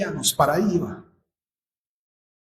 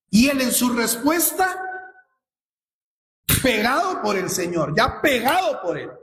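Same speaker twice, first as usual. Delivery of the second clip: raised, urgent preacher's cry; fervent preaching; 8 semitones higher than usual. Acted. Pastor stirring the congregation, raised fervent preaching.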